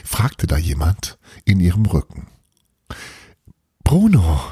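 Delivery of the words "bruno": higher, louder startled call of his name; girl caught by the boy, embarrassed call. Acted. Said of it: "rief Belana, sichtlich verlegen".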